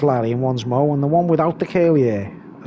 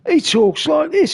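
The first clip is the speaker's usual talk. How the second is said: higher put-on voice of a mate; impression of a friend; 10 semitones higher than usual. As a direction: say it in his friend's voice, pitched up.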